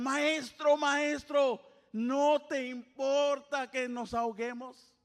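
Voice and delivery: loud desperate cry to the master; shouted plea. Acted. Disciples shouting to Jesus in the storm, loud panic.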